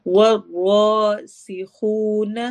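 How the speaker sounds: والراسخون slow, ra heavy, lips rounded. Shown as a demonstration. Teacher reciting for the class. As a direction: recite والراسخون slowly, thick ra, whistling seen. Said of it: "سیٹی کی آواز".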